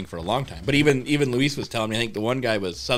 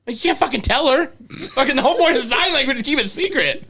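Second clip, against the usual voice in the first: high-pitched, laughing protest; exasperated mock scolding; 13 semitones higher, 3 dB louder than usual. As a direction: scold the little accomplice in high disbelief. Mock scolding kid who told.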